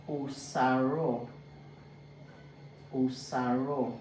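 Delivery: Osaro slowly, the double R drawn out; stressed R in Osaro. Shown as a demonstration. Teacher showing learners a name, R stressed in Osaro. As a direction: lean on the R in Osaro. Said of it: "is more stressed".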